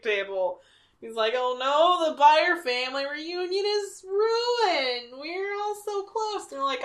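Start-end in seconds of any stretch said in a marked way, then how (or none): 1.4-6.4 high, slow, whiny fake crying; mock sobbing. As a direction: blubber it like a hammed-up farmer in tears. Farmer crying, acted quote.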